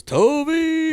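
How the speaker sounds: high drawn-out cheer of the name; crowd goal yell. Retold or imitated. Teammate shows the podcast how everyone yells when they score, yelling high. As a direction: imitate a whole team yelling after a goal. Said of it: "everybody yelling it".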